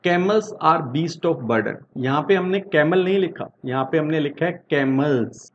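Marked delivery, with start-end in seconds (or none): 0.1-1.7 slow example read. Shown as a demonstration. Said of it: "इस example को देखें".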